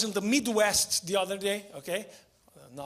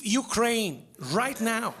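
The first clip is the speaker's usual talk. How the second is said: ordinary delivery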